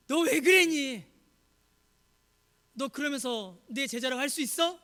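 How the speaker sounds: loud, high outburst easing into reproach; acted angry scolding; accusing rebuke. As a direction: burst out, then question them sternly. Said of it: "그런 책망과 비난을 퍼붓지 않으셨습니다".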